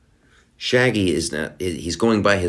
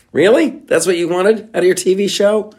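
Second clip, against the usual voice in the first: high, loud disbelief; can't believe what he's hearing; incredulous; 12 semitones higher, 5 dB louder than usual. Real, not acted. Mocking the showrunners' goal, pitched-up disbelief.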